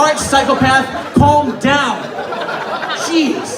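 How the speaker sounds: slow, emphatic put-down; alarmed rebuke. Acted. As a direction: tell a maniac to back off. Imagined listener rebukes the boaster.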